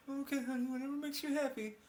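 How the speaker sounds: meek high voice. Retold or imitated. Voicing a meek husband.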